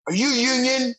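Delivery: high-pitched imitation of a yelling crew guy; mock yell. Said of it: "this crew guy walking by yells at me".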